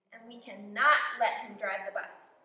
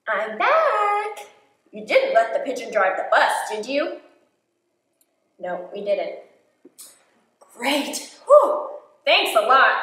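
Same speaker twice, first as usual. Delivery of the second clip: louder, cheerful driver returning; bus driver; 13 dB louder, slower than usual. Acted. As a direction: greet the kids gratefully.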